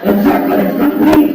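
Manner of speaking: high, loud dramatic proclamation; mock apocalyptic howl; epic villain cry. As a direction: shriek it like a sorcerer's triumph.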